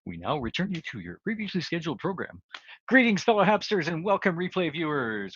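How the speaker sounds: hammed-up old-time broadcast welcome; old radio announcer. Mock broadcast return announcement.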